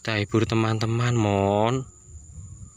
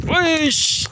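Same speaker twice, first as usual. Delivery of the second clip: very high, loud single call; whistle-like shout to the bird; sharp call; 5 dB louder than usual. Real, not acted. Owner calling pigeon in, sharp high shout.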